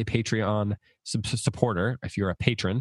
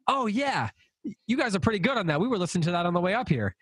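brother-in-law quoted higher; higher-pitched quote; 8 semitones higher than usual. Retold or imitated. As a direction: lift the voice for his line. Brother-in-law voiced in story.

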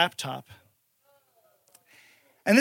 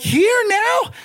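high, laughing indignant squeak; outraged surprise; 10 semitones higher than usual. Retold or imitated. Spotting a roach on the kitchen wall, high indignant surprise. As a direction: jump up into it, disbelieving. Roach appears while playing Madden.

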